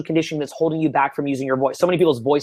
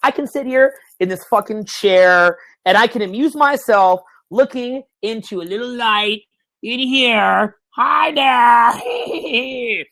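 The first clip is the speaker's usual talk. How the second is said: playful self-amusing voice; 5 semitones higher, much slower than usual. Pretend. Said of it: "you're saying".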